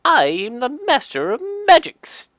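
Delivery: a grand, booming mage, high and loud. Acted. Reading the card, proclaims as the mage in a loud, high voice. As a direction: proclaim it like a pompous wizard.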